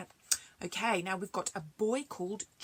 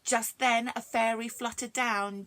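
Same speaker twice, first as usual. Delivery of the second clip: ordinary delivery, nothing marked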